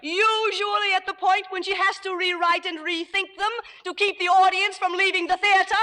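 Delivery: high, sustained indignant comeback; angry retort. Acted. Actress hitting back at a playwright's insult, angry retort. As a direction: hold it high and cutting right through.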